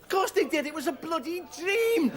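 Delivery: very high-pitched, incredulous outburst; exasperated retort. Acted. Comic answering a boring dream teller, exasperated.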